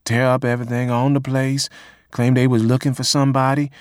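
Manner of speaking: lightly voiced old Missouri woman; voiced witness quote. A narrator's reading. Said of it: "said Ann Ulrich Evans".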